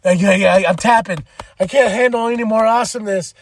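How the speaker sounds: playfully overwhelmed fan; mock overwhelmed. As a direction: tap out from too much fun. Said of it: "I'm like".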